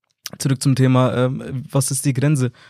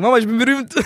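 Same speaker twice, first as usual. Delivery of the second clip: higher-pitched, excited mock boast; excited announcement; 9 semitones higher, 3 dB louder than usual. Son telling his parents he is famous, excited. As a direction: re-enact breaking big news with excitement.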